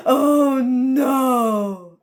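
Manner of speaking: a loud, sobbing cry of dismay. Acted. Calypso wailing at her cave mates, crying out. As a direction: wail it like the tears are coming. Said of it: "Calypso was like".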